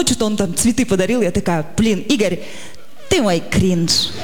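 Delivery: ordinary talk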